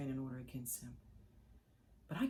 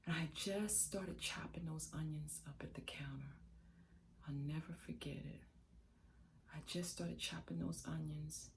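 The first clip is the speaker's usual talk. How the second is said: normal talking voice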